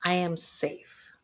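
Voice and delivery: slow, soft, steady repetition; gentle guided affirmation; calm affirmation. Shown as a demonstration. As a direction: soothe yourself with each line. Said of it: "I want you to say the words".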